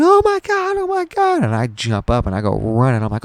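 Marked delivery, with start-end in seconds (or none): 0.0-1.4 very high, loud wailing falsetto; mock crying yell, pitched way up; imitating hysterical mom. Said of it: "yelling and crying".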